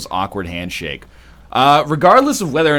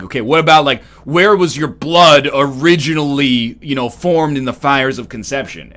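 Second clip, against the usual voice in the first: mock insistent questioning; 2 semitones higher, 7 dB louder than usual. Imitates insistent people asking ancestry.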